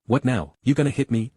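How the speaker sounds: ordinary delivery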